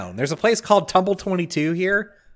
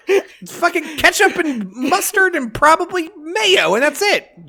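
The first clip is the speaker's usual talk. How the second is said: mock tirade; 5 semitones higher than usual. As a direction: rant like a wronged customer, laughing through it. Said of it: "that's a beautiful tirade".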